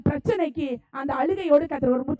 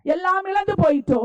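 impassioned preaching; 4 dB louder than usual. Real, not acted. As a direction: preach it forcefully, ringing out each phrase. Preaches with full force.

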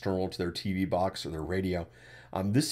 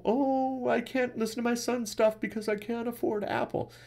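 imitating mom; 14 semitones higher than usual. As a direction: mimic mom's helpless complaint, pitched way up. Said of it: "my mom to this day".

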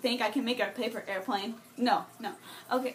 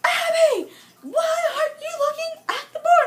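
high, loud screeching teacher imitation; hammed-up scream at a pupil; mock screaming; 10 dB louder than usual. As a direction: shriek it like an outraged teacher. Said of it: "she just starts screaming".

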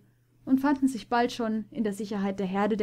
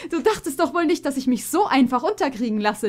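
playful laugh running into the words, high; laughing teasingly; 5 semitones higher, 5 dB louder than usual. A narrator's reading. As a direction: tease your friend while laughing. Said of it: "lachte Silver".

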